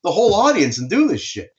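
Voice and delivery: high, heated complaint; exasperated scolding of the floor guy; indignant retort. Retold or imitated. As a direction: pitch up in outraged disbelief. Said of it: "I'm like".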